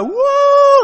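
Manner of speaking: high, loud joyful whoop; ecstatic whoop. Retold or imitated. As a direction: squeal with delight at the truck. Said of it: "she's like".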